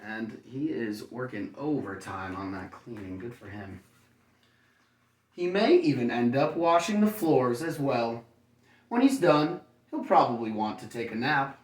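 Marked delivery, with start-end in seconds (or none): 5.3-11.6 bright, lively storybook read, higher and louder; reading to children. A narrator's reading. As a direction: read it like bedtime story to little kids. Reads picture book to children.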